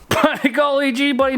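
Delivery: folksy Canadian. Acted. Hammed-up folksy Canadian exclamation.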